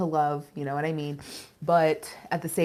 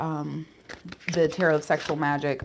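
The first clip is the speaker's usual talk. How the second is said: usual speaking voice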